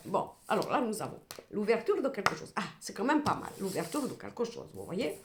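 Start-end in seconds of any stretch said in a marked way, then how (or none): none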